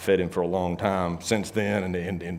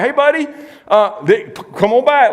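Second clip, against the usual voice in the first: high, louder call to a friend; 13 semitones higher, 7 dB louder than usual. Retold or imitated. Friend calls buddy back from starting a fight, high and loud. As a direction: call him back from trouble.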